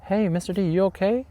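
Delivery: high, bright worried check-in; concerned colleague. Retold or imitated. Colleague at the door checks on teacher, high concerned voice. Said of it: "And she's like".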